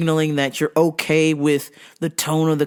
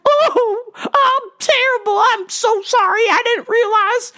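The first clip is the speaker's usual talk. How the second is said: hammed-up high sobbing-sorry lady voice; mock apologetic customer; 5 dB louder than usual. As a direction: gush sorry like a guilty customer. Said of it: "She's like".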